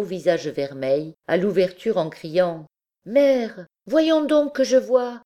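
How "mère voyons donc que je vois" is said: put-on high, loud, bright child calling; a little boy calling out to his mother; higher, louder voice of an eager child; child's voice. A narrator's reading. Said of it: "À cette voix d'enfant, claire, fraîche, sonore".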